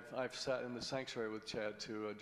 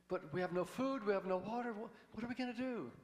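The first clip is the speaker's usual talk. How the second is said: much higher, strained panicking voice; frantic high panic; 8 semitones higher than usual. Acted. Nervous guy panicking, no food or water. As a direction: squeal it out like a man losing hope.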